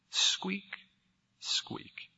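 tiny high squeak, fading to breath; mouse squeak. A narrator's reading. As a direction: squeak like a lost baby mouse.

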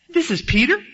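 can this really be him?; incredulous. Real, not acted. Preacher's disbelief at Peter.

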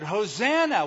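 loud high call of Hosanna; children shouting. Retold or imitated. Children of the area shouting to Jesus, a loud high call. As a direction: cry it out like a crowd of kids. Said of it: "And they shouted".

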